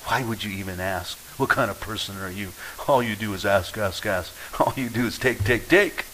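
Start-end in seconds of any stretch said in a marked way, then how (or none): none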